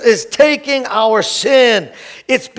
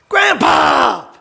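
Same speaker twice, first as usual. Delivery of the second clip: higher, called out longingly; calling out; 4 semitones higher than usual. Retold or imitated. Pastor calling to his late grandpa, longing call. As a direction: call out to him across the years.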